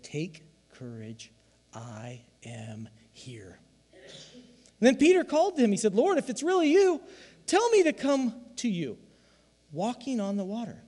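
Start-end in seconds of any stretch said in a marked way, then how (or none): 0.0-3.6 slow, low, much softer Jesus voice; hushed, slow reassurance from Jesus; gentle Jesus. Acted. Voicing Jesus in scripture reading. 5.9-10.8 high, loud plea to Jesus; Peter calling out. Acted. Voicing Peter calling from boat.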